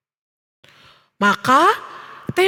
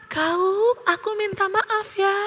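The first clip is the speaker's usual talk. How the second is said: soft, high, drawn-out repeat; echo; 5 semitones higher, 6 dB quieter than usual. A narrator's reading. Valley echo answers Theo's apology, echoing softly. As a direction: give it back like a distant echo.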